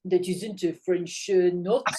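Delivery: slow, halting mock French accent; attempted French accent. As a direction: have a go at a French accent, word by word. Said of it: "They'd probably say in a French accent".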